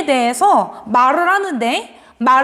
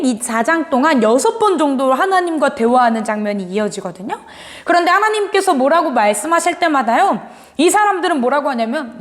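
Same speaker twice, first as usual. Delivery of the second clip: usual speaking voice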